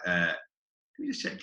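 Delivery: a cheeky put-on casual tone; mock casual stalling. Retold reply to BBC offer.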